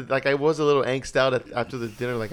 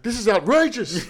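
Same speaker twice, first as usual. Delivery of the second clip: higher, louder and slow, a grumpy geezer; mock outraged old man; 6 semitones higher, 6 dB louder than usual. Customer grumbling at the stamp price, mock outraged old man. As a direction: ham up a cranky old codger.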